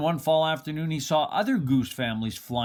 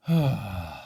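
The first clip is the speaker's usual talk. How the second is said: weary sigh; 5 semitones lower than usual. Acted. Nothing worked, Bruce gives up.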